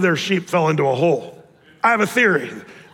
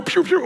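whoosh sound; 11 semitones higher than usual. Retold or imitated. Men fleeing one by one.

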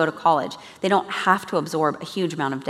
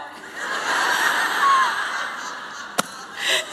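a real high-pitched delighted laugh. Real, not acted. Laughing at the Australian wife's video, high delighted laugh. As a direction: burst into a high, breathy laugh.

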